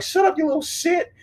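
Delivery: high, shouted mock snap at the kid; a camper yelling at a kid to shut up; retold rude snap. Camper yelling a high mock snap at the kid. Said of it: "He was just like".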